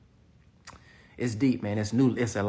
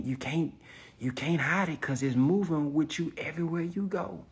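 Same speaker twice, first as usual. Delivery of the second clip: normal talking voice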